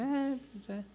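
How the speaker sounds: high, soft noncommittal noise; mock indifference. Many people asked about God, mock indifferent. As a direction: shrug it off in a small voice. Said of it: "Porque mucha gente dice".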